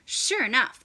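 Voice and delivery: plain reading voice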